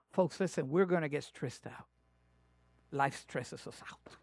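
ordinary talk

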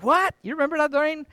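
high-pitched retold disbelief; astonished. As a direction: act out surprise, voice jumping up. Retold shock at paid dinner.

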